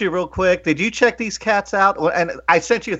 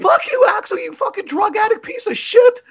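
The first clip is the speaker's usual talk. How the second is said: shrill, much higher mock yell; mock angry commenter. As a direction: ham up an online troll's rage. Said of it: "the comment is like".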